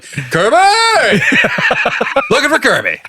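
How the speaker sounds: high calling-out voice, laughing; hollering a name into the house like a fanfare; mock announcement. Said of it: "be like".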